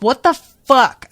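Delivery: high, loud indignant shout; angry outburst. Retold or imitated. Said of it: "somebody get in his face and be like".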